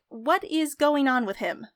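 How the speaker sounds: ordinary delivery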